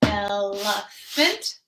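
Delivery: very slow, elephant spaced in three beats; rhythmic drumming count; drummed syllables. Shown as a demonstration. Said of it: "if you're drumming, would go".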